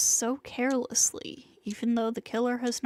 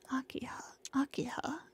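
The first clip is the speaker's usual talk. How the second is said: soft, much quieter whisper of her name; a hushed, fixated whisper; obsessive whisper; 10 dB quieter, faster than usual. Acted. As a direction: breathe her name like a secret craving. Killer spots his sister, obsessed.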